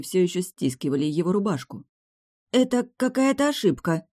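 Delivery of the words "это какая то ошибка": high-pitched, pleading hope; cling to the hope it's a mistake; hopeful protest. A narrator's reading. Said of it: "с надеждой взглянула на него". (с надеждой, высоким голосом)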